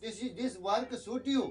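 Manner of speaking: very slow; slow English quote. Retold or imitated. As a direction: dictate word by word. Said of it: "ऐसे बोला।".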